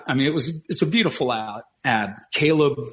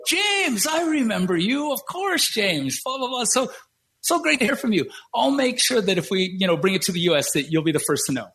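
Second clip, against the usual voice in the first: much higher, gushing friendly voice; Italian CEO; 8 semitones higher than usual. Retold or imitated. Voicing the CEO's email, high gushing voice. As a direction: sing it out like a delighted old friend.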